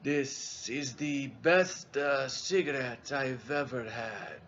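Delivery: put-on slow, savoring Italian-accented English; hushed, drawn-out Italian accent; Italian captain accent. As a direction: relish each word like a fine wine, softly, in an Italian accent. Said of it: "Italian, you know, American broken English".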